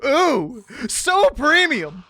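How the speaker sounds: loud, high hype over a prize; big showy oohing; mock excitement. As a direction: gush over the reward, hammed up. Hyping up the quest reward.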